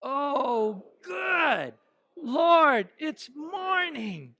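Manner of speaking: joyful, hopeful wake-up cry; optimistic. Shown as a demonstration. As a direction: cheer the morning like good news. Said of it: "let's hear your best optimism".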